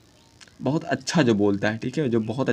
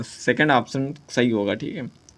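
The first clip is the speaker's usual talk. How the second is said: ordinary delivery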